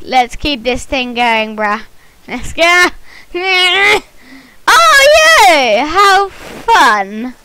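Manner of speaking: usual speaking voice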